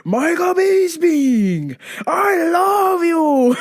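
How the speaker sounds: high, very slow wailing shout; Shits Romero. Acted. Emotional fighter to his rival, over-the-top tearful wail. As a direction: bawl it out, stretching every word. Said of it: "He's like, he's like Shits Romero from the U F C".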